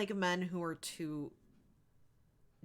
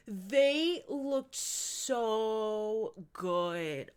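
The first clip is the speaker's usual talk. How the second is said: gushing; much slower than usual. Real, not acted. Gushing over seventies-style men.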